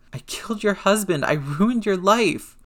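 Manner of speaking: high, anguished self-blame; voice cracking. A narrator's reading. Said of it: "Peter's stupid-ass voice cracked".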